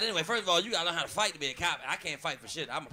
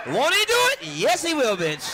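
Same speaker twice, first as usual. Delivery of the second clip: higher, louder, hyped call-out; hyped shout; 6 semitones higher, 7 dB louder than usual. Acted. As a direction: hype the crowd like a preacher.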